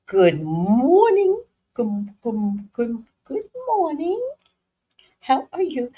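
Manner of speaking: high, airy put-on office greeting; corporate office voice. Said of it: "I didn't know how to talk like that".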